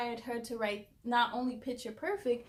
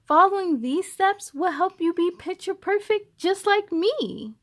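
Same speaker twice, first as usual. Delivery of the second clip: rhyming lines read out brightly, a bit higher and louder; poem recital; 5 semitones higher, 9 dB louder than usual. A narrator's reading. Reading the book's closing poem to kids, sing-song recital. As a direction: recite it like a cheerful rhyme.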